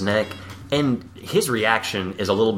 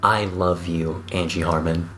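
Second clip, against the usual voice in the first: slow tender declaration; 7 semitones lower, 3 dB quieter than usual. Retold or imitated.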